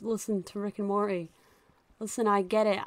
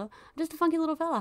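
higher, faster silly singsong; playful funky fella; 8 semitones higher, faster than usual. Pretend. Player pretends to be a carefree funky little fella. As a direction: sing it out like a goofy little guy. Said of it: "just me being a funky little guy".

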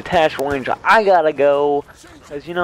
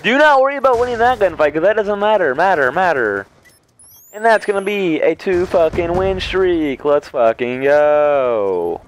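hyped celebration; 2 semitones higher, 4 dB louder than usual. Real, not acted. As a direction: pump up the team after winning. Hyped celebration after win.

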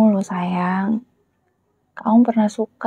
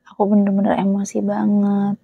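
usual speaking voice

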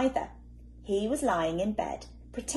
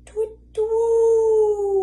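high, loud hooting call; hooting owl; 11 dB louder than usual. Acted. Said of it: "went the owl".

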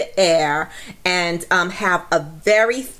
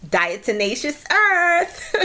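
drawn-out, higher reveal of the topic; excited announcement; 7 semitones higher, slower than usual. Real, not acted. Host revealing today's topic to viewers, excited and drawn out. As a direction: announce it like a game-show prize.

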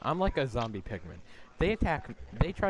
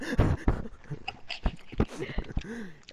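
breathy laugh; 9 semitones higher than usual. Real, not acted. Laughs at own story.

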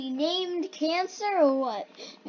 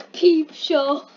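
savoring saying it with a laugh; enjoy saying it, half laughing; relishing the words; 4 dB louder than usual. Shown as a demonstration. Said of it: "I just like saying Peep Show".

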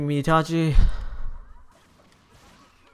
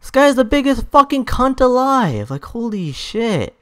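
higher-pitched, indignant outburst; exasperated rant; 8 semitones higher, 3 dB louder than usual. Real, not acted. Player ranting about the worst opponent, exasperated rant. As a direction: vent real outrage at the opponent.

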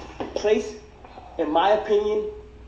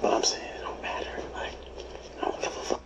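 whisper; 7 semitones higher, 13 dB quieter than usual. Real, not acted. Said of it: "whispered side chat".

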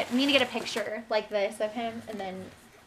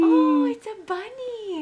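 high, slow cooing; baby talk; 6 semitones higher, 5 dB louder, slower than usual. Acted.

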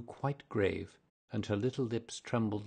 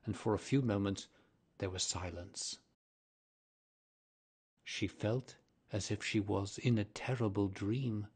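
plain reading voice

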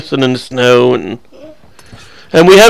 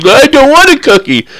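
put-on high whiny little kid voice; much higher put-on childish whine; 11 semitones higher, 4 dB louder than usual. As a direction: refuse it like a sulking child.

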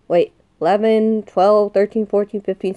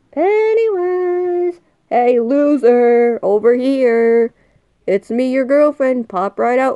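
taunting call; 2 semitones higher than usual. Real, not acted. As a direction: taunt the monster to come out. Taunting Pennywise to appear.

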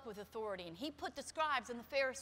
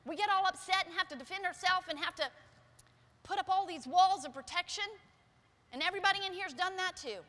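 louder, raised preaching; louder fervent preaching; 5 semitones higher, 8 dB louder than usual. Acted. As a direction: drive the point home to the congregation.

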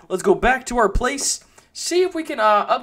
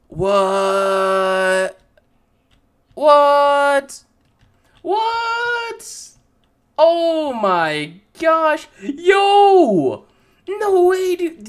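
louder and higher, shouting in real disbelief; amazed shouting; 7 semitones higher, 6 dB louder, much slower than usual. Real, not acted. Gamer finds the secret room, shouts in real amazement. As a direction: yell out in pure shock at the discovery.

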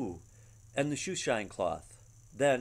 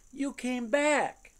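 much higher, slow, drawn-out joyful words; overjoyed; 11 semitones higher, 4 dB louder, much slower than usual. Acted. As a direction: stretch it out in happy disbelief. Sophie overjoyed at his return.

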